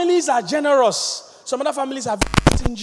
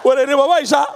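rowdy insult greeting; 5 semitones lower, slower than usual. Retold or imitated. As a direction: hurl friendly insults with a laugh.

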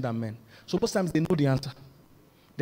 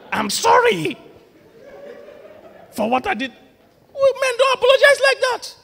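high mock apology; 7 dB louder than usual. Mocks man's apology, then exclaims.

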